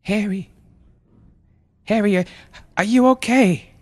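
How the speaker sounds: high, halting, fearful check; worried mother. Acted. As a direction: ask anxiously, stammering and rising in pitch. Mother checks motionless son.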